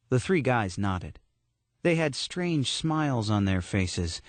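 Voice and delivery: plain reading voice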